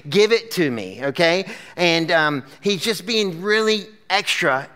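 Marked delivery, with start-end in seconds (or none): 0.1-1.0 hammed-up hungry demand, slow and punched; emphatic. Hungry older brother demanding stew, slow and emphatic. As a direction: overplay every word as a dramatic demand. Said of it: "He's being emphatic".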